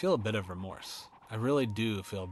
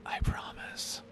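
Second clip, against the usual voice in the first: whisper; much slower than usual. Acted. Creepy threat to homeowner.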